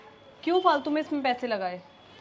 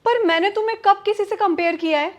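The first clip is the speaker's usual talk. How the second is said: louder, higher challenge; indignant denial; 4 semitones higher, 5 dB louder than usual. Acted. Wife demands when she ever compared husband, indignant. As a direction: challenge him heatedly.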